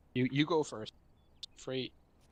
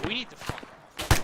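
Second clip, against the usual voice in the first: urgent shout; 9 semitones higher, 8 dB louder than usual. Real, not acted. Urgent call to retreat under fire.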